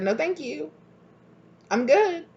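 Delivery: light, high, airy no-thank-you; breezy high refusal. Retold or imitated. Brushing off the person who kept pushing, airy and high. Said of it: "you're like".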